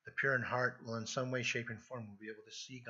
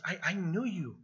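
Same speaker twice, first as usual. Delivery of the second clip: "I knew you" louder and higher, pitch soaring on "knew"; emphatic cry; 6 semitones higher, 5 dB louder than usual. Acted. People protesting to Jesus at the judgment, emphatic cry. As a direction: plead it, swelling on "I knew you". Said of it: "it's a cry of emphasis".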